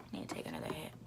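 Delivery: breathy unvoiced whisper; whispered aside under the breath. Real, not acted. Aside muttered off camera.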